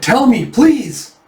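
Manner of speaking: high, slow, loud begging; desperate plea. Retold or imitated. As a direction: beg for the answer. Begging news of the princess.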